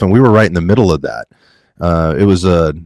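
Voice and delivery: ordinary talk, nothing marked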